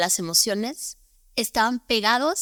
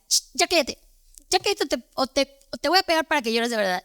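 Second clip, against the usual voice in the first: high, sharp mock scolding of a crying child; angry scolding mother; 4 semitones higher, faster than usual. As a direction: imitate a mother snapping at her child to stop crying.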